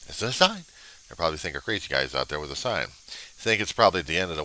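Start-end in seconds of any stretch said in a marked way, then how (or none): 0.0-0.7 higher-pitched, questioning lift at normal volume; milder repeat. Acted. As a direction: repeat it high, rising at the end. Follow-up to the protester's scream.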